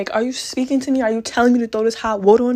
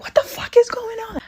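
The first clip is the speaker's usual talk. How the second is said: high breathless whisper-yell; hissed high mom outrage; mom bursting in. Retold or imitated. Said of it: "busting the door like".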